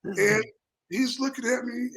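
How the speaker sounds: ordinary delivery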